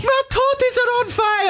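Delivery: very high hysterical falsetto; hammed-up Scottish nanny panic; Missus Doubtfire. Presenter escalating the silly impression, squealing falsetto. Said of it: "if you can do Missus Doubtfire".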